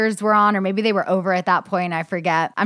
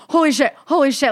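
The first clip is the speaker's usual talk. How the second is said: freaking out; 5 semitones higher, 3 dB louder, faster than usual. Retold or imitated. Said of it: "I'm freaking out".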